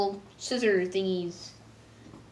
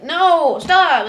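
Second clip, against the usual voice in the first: higher, louder cry of no; mock yell; 8 semitones higher, 11 dB louder than usual. Marco begging Star to stop the spell, yelled. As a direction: shout it as the hair grows. Marco protests growing hair.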